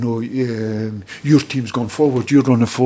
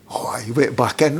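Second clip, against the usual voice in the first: plain reading voice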